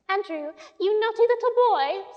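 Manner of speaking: high, loud fussy-lady scolding; Miss Lark imitation. A narrator's reading. Jane teasing the dog in Miss Lark's voice. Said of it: "her voice ... sounded a bit more like Miss Lark".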